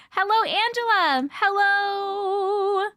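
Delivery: ordinary talk, nothing marked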